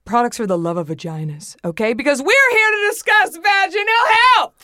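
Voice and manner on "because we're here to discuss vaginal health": excited announcement. Real, not acted. Host hyping the topic.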